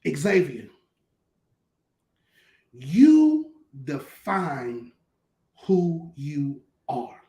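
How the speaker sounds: slow, low, weighty mentor voice with long pauses; measured, low-pitched counsel, drawn out word by word; Willie F. Godman. Retold or imitated. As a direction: speak it slowly and deep, letting each word land. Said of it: "And in his Godman way".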